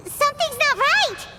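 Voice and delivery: high, urgent cry; alarmed. Acted. Paimon warning the group as darkness emerges, alarmed. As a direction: sound the alarm.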